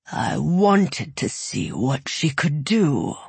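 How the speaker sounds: slow amused rumble; unhurried, toying dragon. Acted. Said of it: "Its voice was a low rumble".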